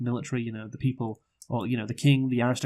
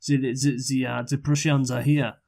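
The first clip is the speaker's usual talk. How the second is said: mock French accent; 2 semitones higher, 3 dB louder than usual. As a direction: put on a comically thick French accent, stumbling a little. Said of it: "put on a French accent".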